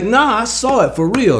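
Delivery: insist, voice jumping up; insistent protest. Retold or imitated. Friends doubting him, high and insistent. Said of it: "I'm like".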